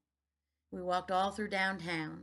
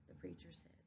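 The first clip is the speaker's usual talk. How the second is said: usual speaking voice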